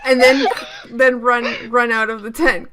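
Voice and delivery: giggling through the words; laughing. Real, not acted. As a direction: laugh while you say it. Laughing while pitching the plan.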